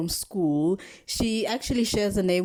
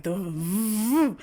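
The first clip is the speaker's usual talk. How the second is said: imitated engine vroom; motorbike noise. Describing the chasing biker in a dream, mock engine vroom. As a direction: mimic a motorbike revving past. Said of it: "You hear the bike".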